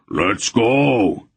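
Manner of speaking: a mild mock bellow. A narrator's reading. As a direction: call the charge. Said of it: "he bellowed".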